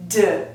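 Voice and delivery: voiced "duh" for "d"; isolated D sound. Shown as a demonstration. Teacher introducing the third possible sound, voiced "d". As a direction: say "d" as a short voiced sound, not the letter name.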